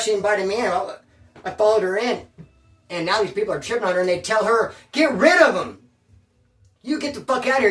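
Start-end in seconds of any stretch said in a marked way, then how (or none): 4.9-7.7 higher-pitched mock command; lightly acted order to get out; imitating angry order. Motel crew tell her to get rid of him, mildly angry retold order.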